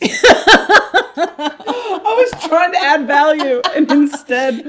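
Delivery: slow, high, loud words through laughter; laughing. Real, not acted. Cohost defends her background music while laughing hard. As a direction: laugh out the excuse.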